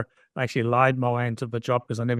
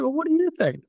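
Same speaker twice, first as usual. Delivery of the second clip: much higher, a put-on wife's voice tossing the question back. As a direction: play the wife, pitched well up and pointed. Wife voiced in retold story.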